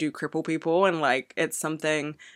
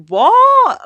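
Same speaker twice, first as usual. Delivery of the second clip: very loud, high disbelief; loud, pitched-up exclamation of disbelief; incredulous outburst; 12 dB louder than usual. Real, not acted. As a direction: burst out, utterly baffled. Disbelief at her own comparison.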